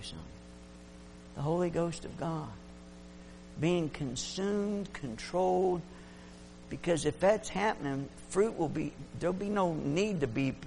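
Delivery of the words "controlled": usual speaking voice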